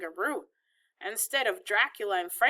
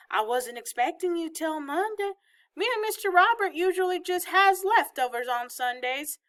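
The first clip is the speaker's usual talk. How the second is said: put-on high, folksy old Southern woman; bustling Southern grandmother; 6 semitones higher than usual. A narrator's reading. Grandmother planning supper for unexpected family, bustling. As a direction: think aloud about supper as the grandmother.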